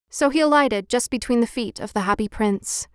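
usual speaking voice

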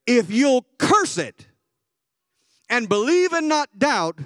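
Jesus sternly charging the father. Retold or imitated. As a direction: proclaim it like a stern Lord. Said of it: "Jesus sternly said".

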